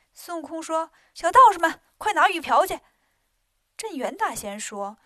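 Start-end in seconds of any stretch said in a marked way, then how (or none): 1.1-2.8 loud, high calling out; ordering loudly. A narrator's reading. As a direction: call out to the little Taoists. Wukong orders the little Taoists. (孙悟空冲小道士们大声喊)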